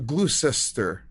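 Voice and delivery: GLOUCESTER read as spelled, in three syllables, glue-sess-ter; literal pronunciation. Shown as a demonstration. American answering how he would say it, literal pronunciation. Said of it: "I'd go to".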